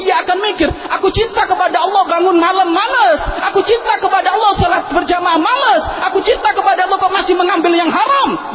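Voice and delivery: high, loud rebuking preach; preaching at full force. Acted. Preacher challenging the congregation's lazy love, loud preaching. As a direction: hammer the hypocrisy home.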